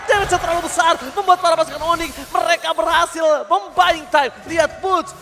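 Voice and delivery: loud and high, slowed on key words; hyped play-by-play. Real, not acted. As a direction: keep the fight call pumped. Caster calling a teamfight.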